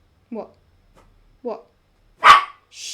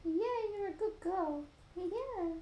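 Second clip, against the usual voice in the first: pet talk; 7 semitones higher, 7 dB quieter, slower than usual. Real, not acted. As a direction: coo praise at the dog. Praising her pet.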